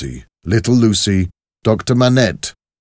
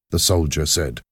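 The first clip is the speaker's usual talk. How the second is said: plain reading voice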